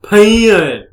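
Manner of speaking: "Pen" loud, high and stretched, southern style; mock southern drawl on "Pen"; exaggerated southern pronunciation. Shown as a demonstration. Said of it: "can't say it like a southerner".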